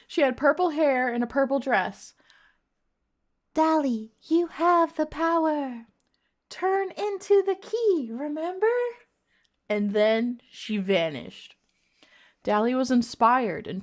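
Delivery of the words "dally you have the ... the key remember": high, slow fairy queen voice. A narrator's reading. Fairy Queen reminds Dally of her power, high and slow. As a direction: intone it high and drawn-out like a magical queen.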